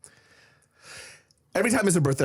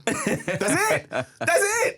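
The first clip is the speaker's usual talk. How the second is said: laughing; much slower than usual. Real, not acted. Laughs at his own reason.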